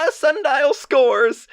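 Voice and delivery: high, laughing victory cheer; excited whoop. Acted. Sundial opening his audio diary, excited and triumphant. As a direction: crow it, drawn out, through a laugh. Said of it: "wanted to try opening one of these things a little excitedly".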